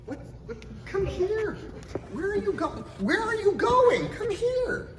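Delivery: high-pitched, laughing call; amused calling out. Real, not acted. Calling someone back, amused.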